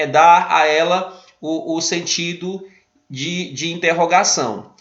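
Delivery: ordinary talk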